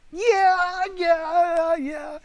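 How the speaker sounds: very high drawn-out squeal, imitating her; mock a lovestruck girl's thrilled yes; squealed falsetto impression; eager girlfriend.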